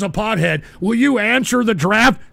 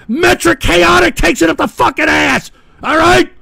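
loud, high-pitched angry roll call; shouted tirade; 6 semitones higher, 5 dB louder than usual. Real, not acted. Host insulting Rumble chat one name at a time, angry yelling. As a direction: yell each insult with the same hammering force.

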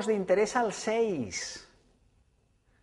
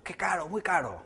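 mock annoyed buyer; 5 dB quieter than usual. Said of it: "me voy enfadado diciendo que es muy caro".

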